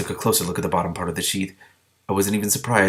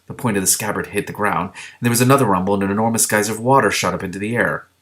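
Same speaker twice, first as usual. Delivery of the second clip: usual speaking voice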